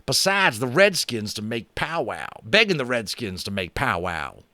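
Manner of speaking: high, twangy cowboy drawl, slow at first; a brash cowboy boasting about guns; cowboy Boone. A narrator's reading. Narrator gives cowboy Boone a high boastful voice.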